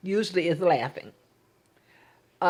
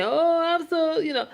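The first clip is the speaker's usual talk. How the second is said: high, gushing mock sympathy; a sugary pitying coo; 9 semitones higher than usual. Mimicking what a friend might say to the widow, gushing mock pity. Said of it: "she wouldn't want me to say".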